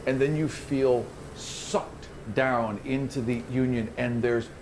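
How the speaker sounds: slow, hushed vision narration; game master's scene setting. Acted. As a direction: draw them into the eerie vision slowly. GM describes the vision.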